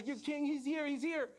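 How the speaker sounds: high, urgent heralding; excited announcement. Acted. Servants telling the king he has arrived, excited announcement.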